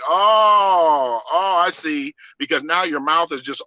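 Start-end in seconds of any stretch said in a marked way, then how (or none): 0.0-2.1 higher, louder dawning oh; sudden dawning realization; loud high oh of understanding. Retold or imitated. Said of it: "I was like".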